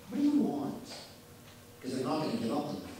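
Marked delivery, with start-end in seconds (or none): 0.1-0.8 high, pointed what do you want; exasperated inner question. Retold or imitated. Speaker silently challenging the landless activist, high and exasperated. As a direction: throw the question up in disbelief. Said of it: "I thought to myself".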